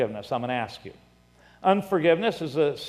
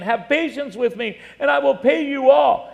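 higher, rising appeal read aloud; louder on the promise, imploring; begging; 9 semitones higher, 6 dB louder than usual. A narrator's reading. Fellow servant begging for time to pay, pleading. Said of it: "begged him, saying".

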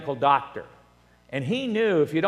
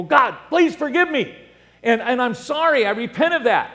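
acted plea to God, higher and louder; pleading prayer; 8 semitones higher, 5 dB louder than usual. Repeat sinner asking God to forgive them, imploring. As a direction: play a penitent begging pardon.

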